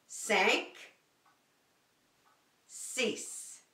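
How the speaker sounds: cinq nasal, six as "sees"; French numbers. Shown as a demonstration. Teacher counts in French for the class: cinq, six. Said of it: "in French".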